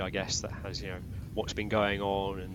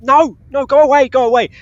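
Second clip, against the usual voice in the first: mock panicked shooing; 14 semitones higher, 17 dB louder than usual. Player swatting the ad.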